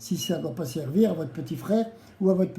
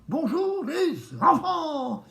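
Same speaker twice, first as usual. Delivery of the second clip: high, slow, sing-song greeting; silly clown; 9 semitones higher, slower than usual. Acted. Clown host greets the children.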